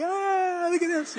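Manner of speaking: put-on delighted child. Grandson showing off the vent mischief, high and gleeful. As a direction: sound like a thrilled toddler.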